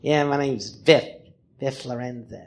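low, slow curled-lip veteran; Biff Lorenzo. Acted. Comic introduces his sketch character, low slow weirdo. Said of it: "and he's like this".

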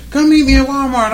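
high put-on wifely summons; imitating his wife. Wife asking husband to meet at Walmart, imitated high. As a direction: pitch it up as the wife calling. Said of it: "She quick to be like".

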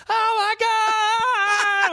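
high, drawn-out shout of disbelief; ecstatic shout. Retold or imitated. Teen boys in the elevator, thrilled about the woman: long high shout. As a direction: scream it out, stretched and thrilled.

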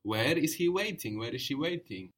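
normal talking voice

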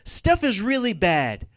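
loud, high cry out to the Lord; David's loud lament. Retold or imitated. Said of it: "and David is like".

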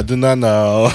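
put-on Spanish accent. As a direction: plead ignorance in a mock Spanish accent. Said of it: "still doing a Spanish accent".

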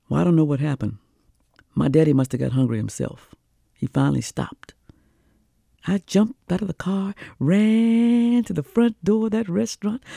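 ordinary delivery

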